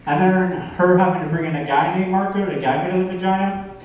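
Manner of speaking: usual speaking voice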